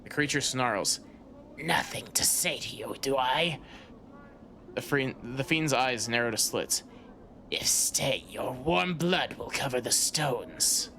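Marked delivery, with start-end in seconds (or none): 1.6-3.6 whispered, hissing fiend voice; rasping fiend hiss. Acted. Reptile fiend hissing at a stranger on the street, rasping whisper. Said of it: "Creature speaks in a rasping hiss". 7.5-10.9 slow, menacing hissed threat; rasping fiend hiss. Acted. Fiend warning the stranger to leave, slow rasping hiss. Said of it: "Creature speaks in a rasping hiss".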